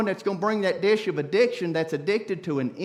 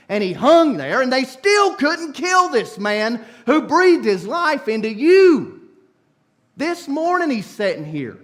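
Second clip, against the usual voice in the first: louder, driving preacher's cry; fiery preaching; 2 semitones higher, 6 dB louder than usual. Real, not acted. Preacher telling the cross story, fiery. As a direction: build it with full force.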